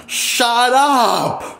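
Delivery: high-pitched yelled "Shut up"; retelling a yell at people who won't listen; retold yell. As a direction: snap it out at the top of your voice. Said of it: "on the top of my voice".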